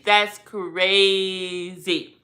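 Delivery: "crazy" stretched very long and slow; very slow, elongated "crazy"; drawn-out emphasis. Real, not acted. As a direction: drag out "crazy" in slow disbelief.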